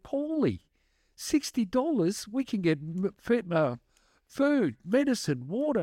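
high, excited nun's voice; mimicking the nun. A nun on the phone, thrilled at sixty dollars, voiced high. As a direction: lift the voice up high for the flustered nun.

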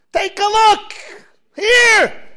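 loud, eager call; excited shout. Acted. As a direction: show it off with a shout. Israeli eagerly shows his arm.

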